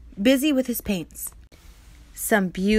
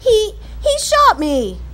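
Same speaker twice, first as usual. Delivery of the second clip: much higher, louder, slow, near tears; shocked; 11 semitones higher, 6 dB louder, slower than usual. Acted. Patrick stunned that the cowboy shot him, crying out. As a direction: stammer it out in disbelief. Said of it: "in a shocked voice".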